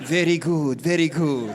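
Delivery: low, smug approving mom; satisfied mom. Retold or imitated. Mom approving as her son drinks it all, low and smug.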